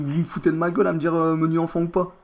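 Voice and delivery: loud, heated mock rebeu French accent; put-on rebeu accent. Customer protesting his order to the fast-food worker, put-on rebeu accent. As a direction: complain loudly in a fake rebeu accent. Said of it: "mon accent".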